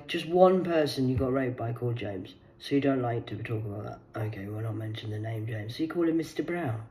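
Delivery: usual speaking voice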